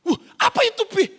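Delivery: high, loud, wowed question; child's amazed piping voice; curious child. Acted. Acted child curious at father's teaching.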